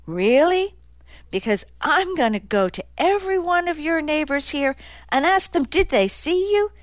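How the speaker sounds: high-pitched, mocking challenge; sarcastic threat. A narrator's reading. Nick doubting Dozer was home, sarcastically threatening. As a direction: call his bluff with rising pitch.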